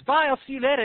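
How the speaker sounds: high, loud bird goodbye; little bird. Acted. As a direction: call out a farewell as a little bird. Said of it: "And so the little bird".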